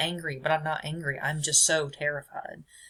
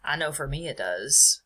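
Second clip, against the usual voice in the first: ordinary delivery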